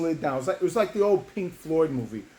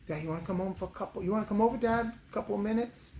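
high, soft wheedling request; imitated daughter, pitched up and quiet; teasing daughter; 8 semitones higher, 3 dB quieter than usual. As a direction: ask dad in a small, high voice. Grown daughter's teasing request voiced.

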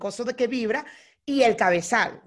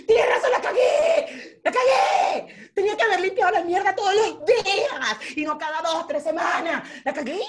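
hammed-up high wailing confession, laughing; confesión a gritos; 7 semitones higher, 3 dB louder than usual. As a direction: shout the apology high, dragging the key words. Confesión gritada al recrear la pelea. (confesión gritada, aguda y teatral)

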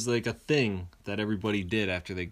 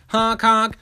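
honk imitation; 11 semitones higher, 8 dB louder than usual. Imitating tram horn.

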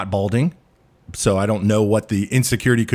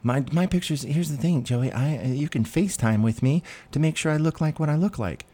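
higher, softer imitation of a woman protesting; mock woman; 3 semitones higher, 5 dB quieter than usual. Woman assures Joey about her pictures, mock high soft voice. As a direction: put on her pleading excuse, raised and softened.

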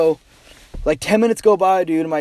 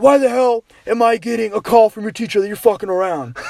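angry dad; 5 semitones higher than usual. Retold or imitated. Angry dad yelling at son.

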